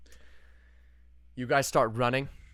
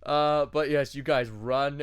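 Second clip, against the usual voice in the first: ordinary delivery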